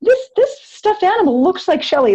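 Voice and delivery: half-amazed, recalling the moment; mock surprise, voice lifting high; recalled wonder. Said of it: "and I said".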